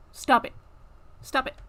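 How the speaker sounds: loud, firm stop it; firm scolding. Real, not acted. Streamer telling her cat to stop chewing wires, loud. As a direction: tell the cat off firmly.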